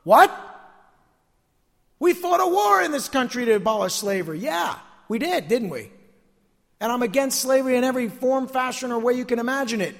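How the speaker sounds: high, emphatic pulpit declaration; insistent sermon delivery; forceful preaching. Real, not acted.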